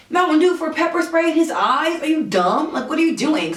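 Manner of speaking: high-pitched exasperated disbelief; incredulous scolding. Retold or imitated. Passenger scolding the Uber driver over his offer, incredulous. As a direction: reenact telling off someone clueless.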